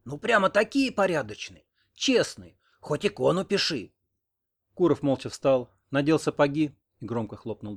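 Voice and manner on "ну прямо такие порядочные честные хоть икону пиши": higher, mock-admiring indignation; indignant. A narrator's reading. Old woman mocks men's supposed honesty, indignant. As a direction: jeer indignantly at their virtue. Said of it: "возмутилась сова".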